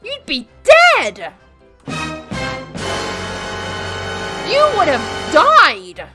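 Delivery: high, slow, loud disbelief; incredulous outburst. Real, not acted. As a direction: blurt it out in disbelief.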